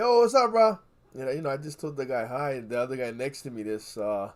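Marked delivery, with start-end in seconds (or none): 0.0-0.8 big, high, loud friendly hail; loud greeting. Retold or imitated. At the gas station, greeting a stranger with a loud cheerful call. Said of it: "I said".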